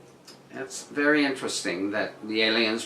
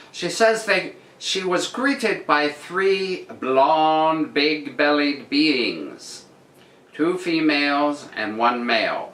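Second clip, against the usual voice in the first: news read; 4 semitones higher, slower than usual. Acted. Reads the article again.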